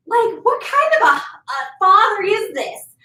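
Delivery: a hammed-up trickster father turning on his son; shocked Anansi. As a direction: blame the son in a hammed-up outraged voice. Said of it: "And Anansi's like".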